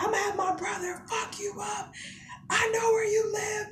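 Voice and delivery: very high, held-back tearful scream; strained high mock shriek. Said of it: "I'm screaming at him".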